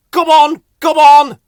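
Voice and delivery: loud, high calling. Acted. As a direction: call out to wake them. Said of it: "he called".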